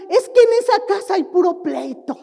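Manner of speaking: gossiping complaint. Acted. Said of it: "Que no digan".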